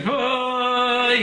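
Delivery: a high, mock war whoop, half laughing; battle cry. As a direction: yell it like a comic charge of yeshiva boys. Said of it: "running towards them going".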